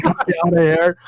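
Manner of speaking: high-pitched hammed-up begging; mock panicked plea. Trapped victim at the gate, mock panicked plea. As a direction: beg to be let out, half laughing at it.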